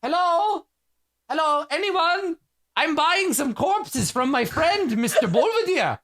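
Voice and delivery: high, slow sing-song calling into the alley; mocking call to nobody, with laughter; high mocking hello to an empty street. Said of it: "For example".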